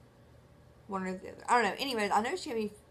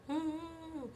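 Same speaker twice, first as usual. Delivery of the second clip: mock gag noise; 8 semitones higher, 6 dB quieter than usual. Trying to swallow the scope.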